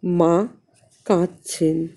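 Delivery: ordinary delivery, nothing marked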